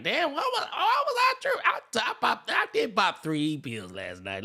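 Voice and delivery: squeaky high mock wail, then dropping back down; high mock whine of a crying partier; mocking emotional guy. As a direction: mimic a pill-weepy guy, high and whiny. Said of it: "You like".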